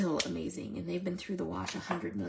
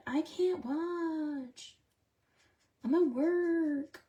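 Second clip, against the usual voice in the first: viewer quote voice; 8 semitones higher, much slower than usual. Retold or imitated. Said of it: "she's always like".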